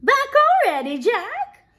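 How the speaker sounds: high, loud excited greeting; eager mother. Acted. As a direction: call out in delighted surprise as Jack's mother. Mother greets son back from market.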